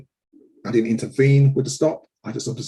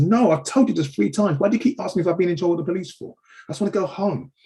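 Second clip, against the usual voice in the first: put-on higher, fast, pleading teenage protest; imitating frightened boy; 8 semitones higher, 3 dB louder, faster than usual. Boy in handcuffs pleading with officers, higher and rushed. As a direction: play the boy, quick and higher, begging to go home.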